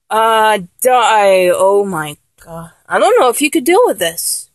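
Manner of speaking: ordinary talk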